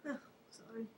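choked-up hushed whisper, no voice; whispered apology. Pretend. Host apologising to viewers for getting emotional, whispered. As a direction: whisper sorry as if overcome. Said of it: "I get a bit emotional".

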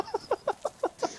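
high laugh. Real, not acted. Laughs at own complaint.

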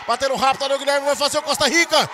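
loud, fast startled shout; excited shout. Real, not acted. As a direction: erupt as the chance flies by. Near-goal chance, commentator erupts.

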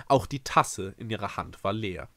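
ordinary delivery

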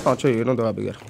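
ordinary delivery

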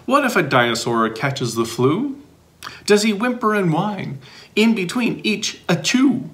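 put-on lively read-aloud for young listeners; bouncy picture-book reading; reading to children. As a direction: read it like story time for kids.

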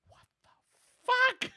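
slow, hushed start bursting into a high shout; stunned outburst. Retold or imitated. Client retelling shock at a psychic's reading, stunned shouted outburst. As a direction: drag out the first words, then explode on the curse.

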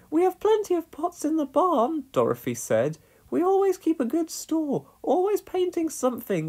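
high, cheerful farm-wife voice; Dorothy voice. A narrator's reading. Dorothy offers Pat paint from the barn. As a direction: offer the paint with homely pride.

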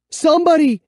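high, loud desperate yell; frantic high shout; shouting for help. A narrator's reading. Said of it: "I shouted".

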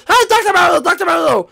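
high, loud excited hail; play-acted urgent call. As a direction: holler a greeting across the room. Calls out to the doctor.

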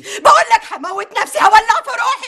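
frantic threats. Acted. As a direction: escalate into a desperate outcry. Mother threatens self-harm, building.

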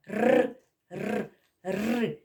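Р trilled and repeated; rolled r. Shown as a demonstration. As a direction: roll the Р for the children. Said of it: "Який перший звук?". (розкотисте «Р»)

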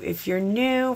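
normal talking voice

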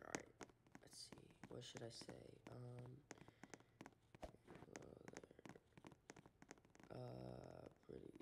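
hushed whisper under breath. Real, not acted. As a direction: whisper it to yourself. Thinking aloud while choosing chat words.

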